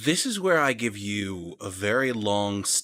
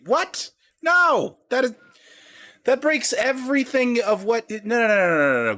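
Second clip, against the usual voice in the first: much higher, louder disbelieving no's; shocked protest; 11 semitones higher, 5 dB louder than usual. Real, not acted. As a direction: refuse in genuine alarm. Player protests blood bond.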